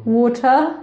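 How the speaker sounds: "water" with a clearly enunciated t, no final r; crisp-t "water". Shown as a demonstration. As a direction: hit the t in "water", end on "tah". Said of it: "and then sometimes I say water".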